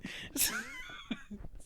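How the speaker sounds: high, breathless laughter; real laughing fit; high squealing laugh. Real, not acted. Laughing at accent attempt.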